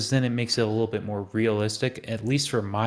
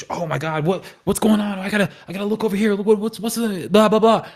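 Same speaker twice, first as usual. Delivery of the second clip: high, rushed panic chatter; panicked climber; 8 semitones higher, 3 dB louder, faster than usual. Retold or imitated. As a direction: babble like you've lost your grip in fear. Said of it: "But when you're panicking".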